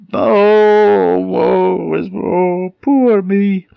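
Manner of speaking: loud, high, long-held moans; terrible wailing voice. Acted. Vixen hears it from her cave, terrible wailing. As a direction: wail it out like a ghostly lament. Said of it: "it's got a terrible voice".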